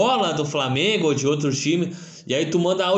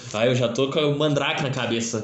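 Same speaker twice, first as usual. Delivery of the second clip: usual speaking voice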